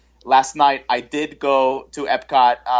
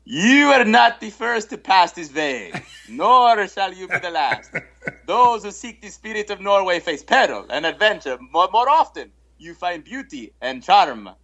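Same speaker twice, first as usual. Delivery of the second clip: put-on Norwegian accent; 5 semitones higher than usual. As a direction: intone it grandly like a ride narrator with a Norwegian accent. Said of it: "my best Norwegian accent".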